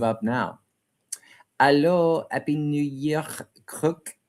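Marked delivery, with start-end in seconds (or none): 1.6-4.2 put-on higher-pitched, playful French-accented greeting. Livestream host greeting a viewer for New Year, French accent. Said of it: "I was doing that in a French accent".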